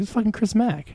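retelling a stunned moment of recognition; surprised recognition. Retold or imitated. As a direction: gasp out the name as it clicks. Sudden recognition in retold story.

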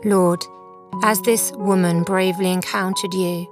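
ordinary talk

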